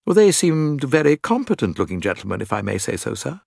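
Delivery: a hint of a proper manservant; lightly voiced manservant. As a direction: offer an opinion respectfully, as a servant.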